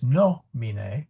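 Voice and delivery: "nomine" as NO-mi-ne, first syllable drawn out; stress on first syllable. Shown as a demonstration. Teacher showing a short-vowel word, stress moved to first syllable. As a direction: lean on the no of "nomine", short i after it. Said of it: "we gotta go one forward".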